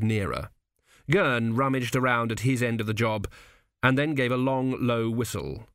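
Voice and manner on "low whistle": ordinary delivery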